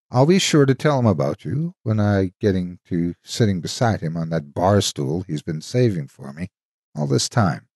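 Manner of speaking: ordinary delivery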